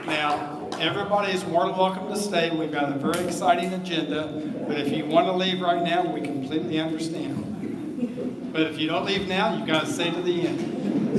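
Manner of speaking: projecting loudly to a crowd; booming announcement to the room. Real, not acted.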